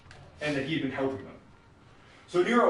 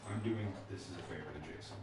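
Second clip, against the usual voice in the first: low and much softer, cold; quiet menace; 9 semitones lower, 19 dB quieter than usual. Acted. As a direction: let the accusation sink in quietly. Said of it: "really intense scene".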